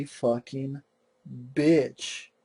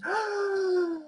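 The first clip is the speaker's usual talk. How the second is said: mock gasping scream; 6 dB quieter than usual. Leatherface screams at her.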